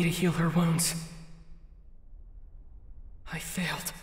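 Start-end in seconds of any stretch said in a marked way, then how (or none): 3.3-3.9 soft, whispered sorry; whispered apology. Acted. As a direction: barely whisper it. Apology to sleeping sister.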